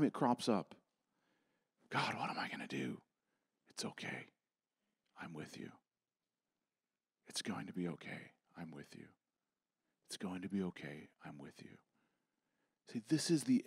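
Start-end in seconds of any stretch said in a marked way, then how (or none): 1.9-3.0 breathy whisper, much softer; hushed worried plea; anxious whispered prayer. Acted. 3.7-11.8 much softer and lower, gentle comfort; hushed voice of Jesus, tender; tender reassurance. Acted.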